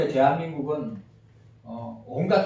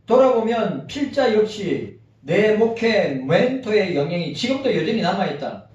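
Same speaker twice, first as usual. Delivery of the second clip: reading aloud; 3 semitones higher, 4 dB louder than usual. Acted. Continues reading the article.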